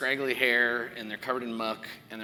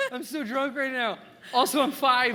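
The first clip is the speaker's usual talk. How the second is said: put-on drunk kid voice; 13 semitones higher, 6 dB louder than usual. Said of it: "if the drunk people are like".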